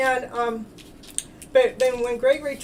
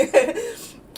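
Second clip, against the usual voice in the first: high burst of laughter; 11 semitones higher, 4 dB louder than usual. Real, not acted. Laughing about the inner tube rides.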